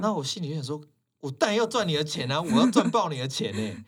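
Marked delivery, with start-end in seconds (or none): 1.2-3.8 louder, higher exasperated protest; of course I want your money!; indignant inner rant. Retold or imitated. (气愤地在心里反驳)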